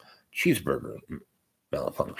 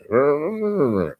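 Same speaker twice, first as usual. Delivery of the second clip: short growl, pitched higher; grumbling growl; 7 semitones higher than usual. Retold or imitated. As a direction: growl your displeasure at the dentist. Reacting to root canal news.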